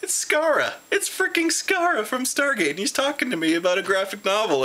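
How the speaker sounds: high, starstruck gushing; starstruck inner squeal. Retold or imitated. Fan meeting the Stargate actor, gushing starstruck and high. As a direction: freak out inside at meeting your hero. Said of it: "I'm like".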